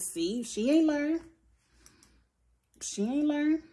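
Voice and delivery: high, drawn-out told-you-so; mock told-you-so. Imagined reaction to Monique dragging Candace, high slow mock told-you-so. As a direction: stretch it out high, like a pretend verdict.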